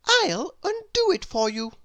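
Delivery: high, bright-eyed know-it-all cry; eager little girl voice. A narrator's reading. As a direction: pitch it way up, keen to answer. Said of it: "said little Miss Brainy".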